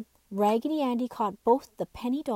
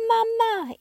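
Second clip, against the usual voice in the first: high, loud squeaky little doll cry; 11 semitones higher, 6 dB louder than usual. A narrator's reading. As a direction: squeal it out like a tiny toy doll. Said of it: "in his squeaky voice".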